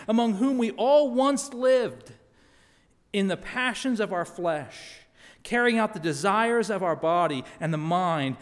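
loud, earnest scripture reading. Acted. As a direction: proclaim the text to the congregation. Reads scripture aloud.

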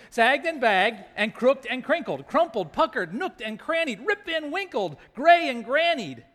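hammed-up self-pity, punching each rhyme; Naomi. Old widow listing her wrinkles for children, hammed-up lament. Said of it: "This is Naomi speaking".

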